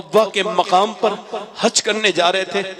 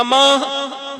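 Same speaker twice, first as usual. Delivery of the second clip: calling out; 8 semitones higher, much slower than usual. Acted. Son calling mother again.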